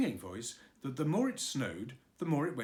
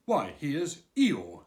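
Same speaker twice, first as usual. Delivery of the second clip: welcoming; 8 dB louder, much slower than usual. Acted. Pooh greeting Eeyore warmly.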